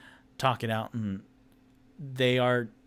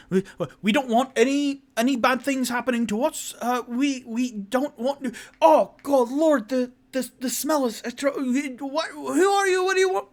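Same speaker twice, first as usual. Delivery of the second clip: high frightened halfling; 14 semitones higher, 6 dB louder than usual. Acted. Frightened halfling family speaking.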